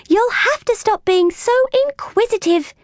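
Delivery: put-on high girlish voice telling off a pet; gentle scolding. A narrator's reading. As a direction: chide the cat in a high voice. Girl gently scolds her cat.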